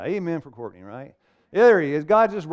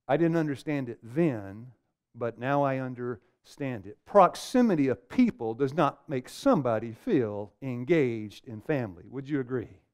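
ordinary talk

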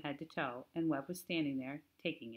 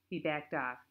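ordinary delivery

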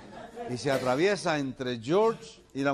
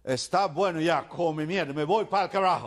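put-on angry, loud, high outburst in accented Spanish; fed up; 7 semitones higher, 6 dB louder than usual. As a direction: spit out the Spanish, every word stressed. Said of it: "George está encabronado".

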